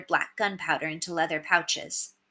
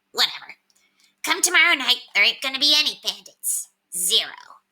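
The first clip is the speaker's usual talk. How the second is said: higher, smug little rat voice; Septimus the rat; 6 semitones higher than usual. A narrator's reading. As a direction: play a cocky talking rat on a shoulder. Septimus grim remark.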